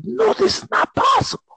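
high shocked squeal. Retold or imitated. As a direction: squeal it in disbelief. Shocked disbelief at terminated call.

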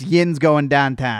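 loud, slow mock Pittsburghese; Pittsburgh Yinzer accent. Shown as a demonstration. Co-host correcting the phrase, mock Pittsburgh accent. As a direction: demonstrate a broad Pittsburgh accent. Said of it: "people who talk like that".

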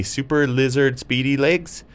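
usual speaking voice

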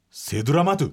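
セドラマトゥ as reversed gibberish; backwards speech. Shown as a demonstration. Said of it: "歌丸さんは".

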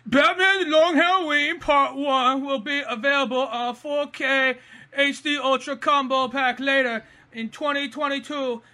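high, put-on voice, reading laboriously; imagined trivia writer's voice. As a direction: read it out in a silly high voice. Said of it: "read it in the voice that I imagine it was written in".

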